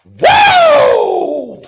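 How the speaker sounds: hyped woo yell. Acted. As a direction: belt out a wrestler's woo.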